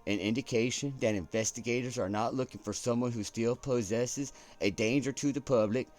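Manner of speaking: hammed-up broadcast read; news anchor accent. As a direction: deliver it like a network anchor. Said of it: "I don't know why I'm getting into this accent".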